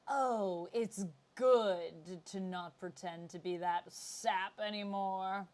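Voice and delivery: theatrical villain, drawn out and grand; campy supervillain monologue, stretched words; Sinister. Acted. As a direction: savour every word as a slow, haughty schemer. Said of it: "that is the voice that plays in my head".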